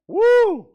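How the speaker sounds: high, loud joyous whoop. Real, not acted. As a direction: let out a big whoop. Whooping exclamation.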